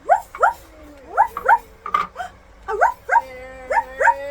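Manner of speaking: high, yappy ruff-ruff; short, loud puppy yips; dog barks. Pretend. Mother showing child what sound Doggy makes, high barks. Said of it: "What sound does Doggy make?".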